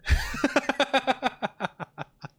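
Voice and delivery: long high-pitched giggle; real laugh, high and trailing off. Real, not acted. Streamer cracking up at own birthday theory, high giggle.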